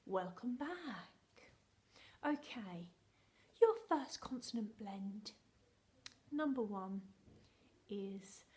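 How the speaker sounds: hushed, secretive whisper to the class; playful conspiratorial whisper; dramatic whisper. Acted. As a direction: let them in on a secret, whispering. Whispered test continues.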